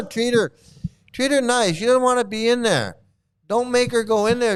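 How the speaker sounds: high pleading. Real, not acted. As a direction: beg them kindly to be nice. Pleading for Radhe.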